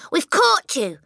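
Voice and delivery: high, loud challenge; yell. Acted. Matilda bursting in with her knife, yell. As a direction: yell it like an armed raid. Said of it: "she yelled".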